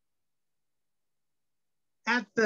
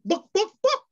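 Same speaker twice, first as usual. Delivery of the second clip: high clucking chicken voice, book as a cluck. Acted. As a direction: cluck the words like a hen. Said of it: "Then the chicken says".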